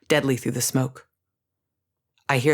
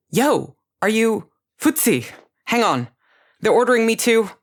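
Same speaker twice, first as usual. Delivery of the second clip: panicked high message; 7 semitones higher, 4 dB louder than usual. A narrator's reading.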